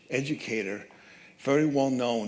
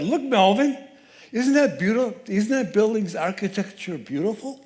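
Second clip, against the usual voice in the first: high, bright-eyed wonder, louder; delighted admiration; 8 semitones higher, 4 dB louder than usual. Retold or imitated. Wife to husband at the crosswalk, high and delighted. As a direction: point out the beauty with a rush of joy.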